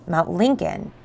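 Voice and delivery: usual speaking voice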